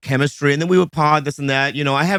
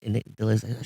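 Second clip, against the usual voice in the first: normal talking voice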